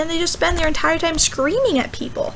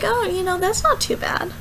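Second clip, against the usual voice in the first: mollified; 3 semitones higher, 5 dB quieter than usual. Retold or imitated. Formerly angry people won over by pope, mildly mollified. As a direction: concede it mildly, voice lifted and quiet. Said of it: "are now like".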